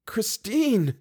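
high-pitched cry of grief; anguished groan. A narrator's reading. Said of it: "groaned Raoul, and he began to sob".

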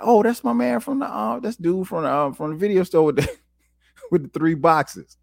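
high, delighted "oh, that's my man"; surprised recognition. Retold or imitated. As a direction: spot an old acquaintance and light up, laughing at the end. Recognizing the neighbor, laughing.

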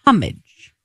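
homage said HUM-ij, H sounded; British homage. Shown as a demonstration. Co-host citing the clip, homage as HUM-ij. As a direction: say homage British style, stress up front. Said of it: "he said people in England say".

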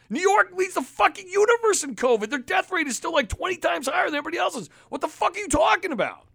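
high-pitched, outraged tirade; incredulous rant. Real, not acted. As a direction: climb high in exasperated disbelief. Incredulous rant at governor's reasoning.

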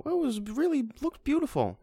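high, put-on moviegoer awe; mock gushing. Reviewer mimicking impressed Pixar viewers, mock gushing. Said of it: "watch a Pixar movie and go".

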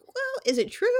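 high-pitched mock questioning voice; mock doubting. Voices half her brain doubting.

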